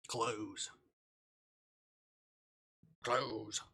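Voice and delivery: usual speaking voice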